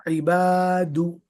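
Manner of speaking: इबादु slowly, long आ held, दु with rounded lips; stretched reading. Shown as a demonstration. Teacher reading the word after drilling the syllable. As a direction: draw out the आ in इबादु. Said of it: "दाल पेश".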